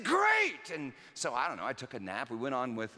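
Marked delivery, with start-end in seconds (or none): 0.0-0.7 much higher, loud, hammed-up cheer; mock enthusiasm. Dad to his daughter, a hammed-up enthusiastic much higher shout. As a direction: fake delight, thinking of a nap. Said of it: "I said, I said".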